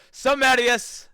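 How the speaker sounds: "Some Atius!" shouted out very loud and high; triumphant shout. Shown as a demonstration. Streamer crowning his character's new name, shouting it proudly. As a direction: proclaim "Some Atius!" like a hero's battle cry. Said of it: "That will be my name".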